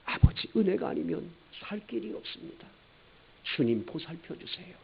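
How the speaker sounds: soft, hushed confession; meek sinner's prayer; humble prayer. Shown as a demonstration. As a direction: plead quietly before God. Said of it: "예수님을 만나 구원을 받을".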